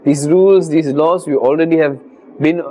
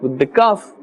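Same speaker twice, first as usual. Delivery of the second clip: ordinary talk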